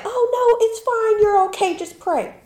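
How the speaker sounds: high, soothing brush-off; parent voice. Retold or imitated. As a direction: mimic a dismissive parent. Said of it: "they be like".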